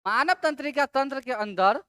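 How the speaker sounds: ordinary talk, nothing marked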